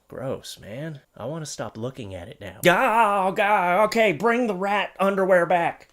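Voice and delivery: ordinary delivery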